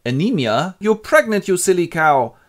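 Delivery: high, loud outraged scolding; a hammed-up mother shrieking at her daughter.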